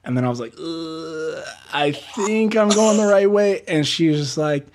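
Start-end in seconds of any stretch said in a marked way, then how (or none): 0.6-3.6 high, unsure mutter to himself; anxious high voice while lost; unsure self-talk. Retold or imitated. Driver fretting to himself about the route, high and unsure.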